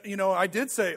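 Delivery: ordinary talk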